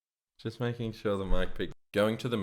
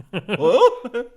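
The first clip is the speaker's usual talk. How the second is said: high-pitched laughing what; high startled what; 13 semitones higher, 8 dB louder than usual. Retold or imitated. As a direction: squeak it out, surprised. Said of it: "someone's like".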